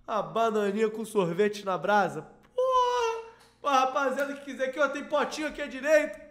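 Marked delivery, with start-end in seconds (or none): 2.5-3.2 hysterical laugh. Real, not acted. Cracks up laughing.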